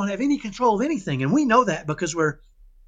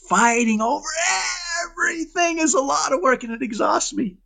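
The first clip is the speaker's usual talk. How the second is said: exasperated shout; 9 semitones higher than usual. Real, not acted. Emphatic point about exhausting fights.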